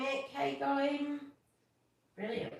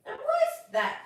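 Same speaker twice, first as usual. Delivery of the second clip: high, loud, laughing question; amused lizard voice; 7 dB louder than usual. Acted. Mister Lizard teasing young Snugglepot, high and laughing.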